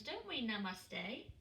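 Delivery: usual speaking voice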